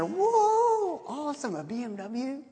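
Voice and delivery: high, amazed whoa; astonished at her new BMW. Retold or imitated. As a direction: react like you just saw the car. Amazed at friend's new car.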